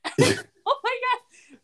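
drawn-out, high "oh my God" through laughter; laughing. Real, not acted. Podcaster reacting to her own episode recap, laughing. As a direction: crack up while reacting to the story. Cracking up at the retold scene.

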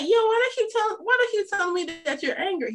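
high-pitched puzzled challenge; retold confrontation. Wife retelling asking her husband about his anger, high challenge.

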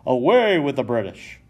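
loud rallying cry; crowd yell. Acted. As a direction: rouse the crowd against the British. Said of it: "yells the small group".

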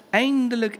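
high, loud cry of relief; relieved exclamation. A narrator's reading. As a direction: burst out with relief. Repeated word, first emphatic.

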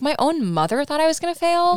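high, loudening wail of disbelief; mock tearful. Daughter hurt by her mother's doubt, mock tearful. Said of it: "that obviously makes Caitlyn cry".

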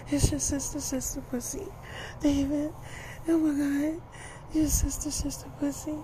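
put-on high, soft girlfriend voice; Tia's voice. Tia answering David in bed, high and soft.

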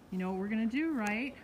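ordinary talk

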